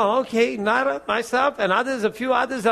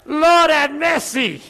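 drawn-out, high, loud cry for mercy; alarmed plea; 5 semitones higher, 5 dB louder, slower than usual. Retold or imitated. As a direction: act out a startled plea to God. Frightened cry to God in story.

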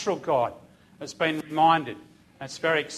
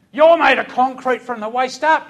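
loud, higher scolding; berating; 6 semitones higher, 9 dB louder than usual. Retold or imitated. Sergeant berates recruits, loud and higher. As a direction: tear into the squad.

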